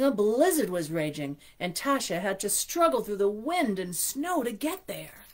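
storybook reading voice; story narration. A narrator's reading. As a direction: read it like a bedtime story. Reads picture book aloud.

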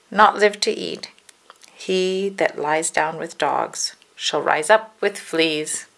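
usual speaking voice

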